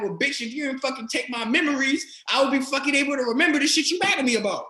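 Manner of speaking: high, loud, rapid-fire rant; heated argument replayed at high pitch; retold yelling. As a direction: yell back in exasperation, acting it out. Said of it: "then I'd be yelling".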